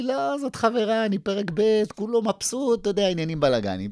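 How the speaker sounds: put-on high, laughing old guy bragging; cheerful old man voice. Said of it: "והוא צוחק, אומר לי".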